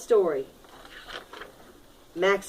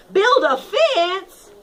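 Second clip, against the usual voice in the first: higher, louder, slow puzzled ant; mock confused ant; 7 semitones higher, 4 dB louder, much slower than usual. Teacher plays a confused ant mishearing the rule. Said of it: "No, no, no".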